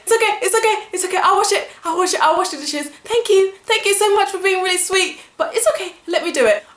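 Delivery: high, fast, over-polite reassurance; forced politeness. Retold or imitated. Host to friend leaving tap running, fake-cheerful. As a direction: chirp it out, hiding annoyance. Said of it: "and you're just like".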